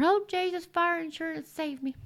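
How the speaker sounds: put-on high, soft, babyish little prayer; mock a kid's fire-insurance prayer, high and hushed; mock child's prayer. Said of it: "you said your little prayer".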